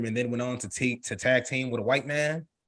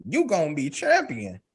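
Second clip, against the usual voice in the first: dramatic mother; 10 semitones higher than usual. Acted. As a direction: read out mama's vow with drama. Reading out a mother's quote dramatically.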